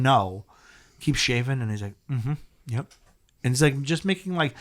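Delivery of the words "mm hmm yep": imitating soft moans of acknowledgement; low approving murmur. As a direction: hum approval softly to yourself. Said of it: "fucking moans and groans".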